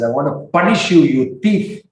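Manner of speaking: playful, emphatic telling-off; acted angry mood. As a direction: threaten punishment in a storyteller's anger. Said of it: "In his angry mood".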